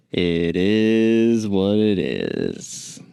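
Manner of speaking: "It is what it is" drawled very slowly in a put-on foreign guide accent; vague foreign tour guide accent. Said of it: "I don't know what that accent was from".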